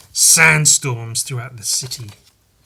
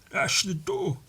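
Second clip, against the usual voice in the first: swollen-faced talk; 8 semitones higher, 6 dB quieter, slower than usual. Retold or imitated. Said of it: "I like how he's talking as well".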